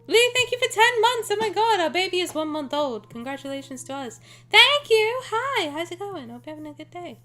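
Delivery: bright high squealed thanks; high excited voice. Real, not acted. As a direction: gush at chat.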